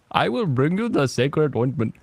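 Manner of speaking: exaggerated mock Indian accent. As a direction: play it as a caricatured Indian accent. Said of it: "making fun of the Indian people".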